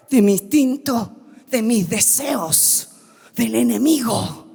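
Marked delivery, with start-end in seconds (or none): none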